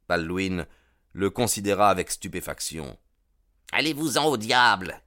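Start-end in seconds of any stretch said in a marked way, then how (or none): 3.7-5.0 higher, louder curse hurled at McMurdo; angry cry. Acted. Baldwin cursing McMurdo who stopped the beating, angry shout. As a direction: snap at him, furious. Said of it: "cria-t-il".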